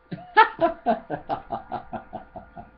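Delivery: airy, unvoiced burst of laughter; a quick laugh on the breath; breathy laugh. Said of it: "Everyone laughed at that".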